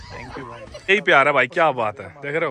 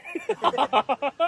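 burst of laughter; 9 semitones higher, 5 dB quieter than usual. Real, not acted. Laugh at the bodybuilder's answer.

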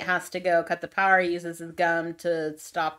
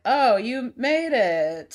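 higher, louder, drawn-out mock surprise; 5 semitones higher, 5 dB louder, much slower than usual. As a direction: fake delight that he showed up, slowly. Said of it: "Sully's like".

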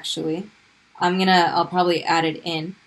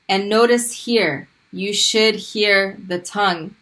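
usual speaking voice

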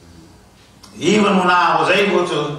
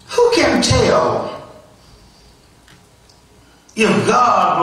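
slow, raised preaching delivery, drawn-out words; emphatic scripture reading; 4 semitones higher, slower than usual. Acted. Preacher asking who can tell if God will turn, fervent and emphatic. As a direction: lift the pitch high and stretch each phrase.

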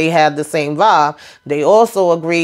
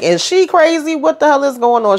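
incredulous disbelief; 8 semitones higher than usual. Retold or imitated. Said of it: "So he was like".

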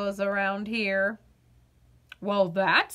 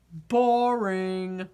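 low, glum potato voice; bored potato; 5 semitones lower than usual. Acted. Potato answering the girl, lower and flat-toned. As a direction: sound like a vegetable with no fun left.

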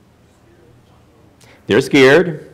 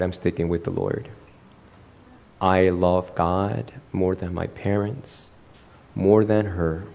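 low, soft, solemn vow; earnest suitor; 5 semitones lower, 6 dB quieter than usual. Acted. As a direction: quietly pledge it, measured and low. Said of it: "If he tells me".

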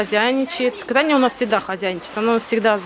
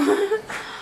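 short laugh; 11 semitones higher, 5 dB quieter than usual. Real, not acted. Laughs about losing voice.

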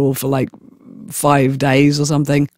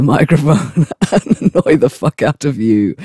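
ordinary talk, nothing marked

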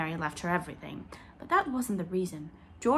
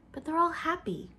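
protesting; 10 semitones higher than usual. A narrator's reading. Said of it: "protested Anne".

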